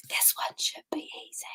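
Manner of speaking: whispered aside. Real, not acted. Quizmaster whispering a hint to the class.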